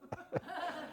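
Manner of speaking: a quick high-pitched giggle; short high laugh at himself; high squeaky giggle. Real, not acted. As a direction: giggle high at your own slip. Amused at own mispronunciation.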